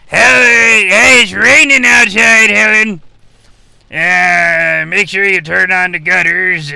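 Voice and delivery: calling out. Real, not acted. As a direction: yell to your wife about the rain. Calling out to wife.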